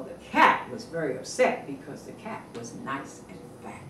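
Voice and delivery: ordinary delivery, nothing marked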